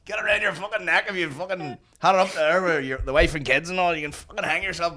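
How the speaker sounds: high mock Belfast sales patter; rattling on as a Belfast rope seller; Belfast accent. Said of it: "a bit of a Belfast accent".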